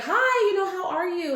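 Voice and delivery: high, loud, delighted hello; re-enacted gleeful, high-pitched hello; super happy greeting. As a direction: greet him as if thrilled to see him. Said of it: "super happy to see him".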